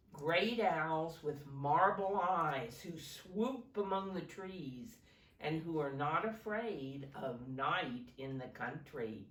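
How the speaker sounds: ordinary talk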